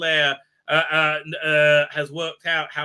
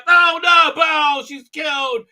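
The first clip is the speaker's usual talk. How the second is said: high, loud hammed-up cry of horror; mock dismay; 10 semitones higher, 10 dB louder, slower than usual. Reacting to a comrade shot, high mock-horrified shout. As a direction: wail in fake alarm.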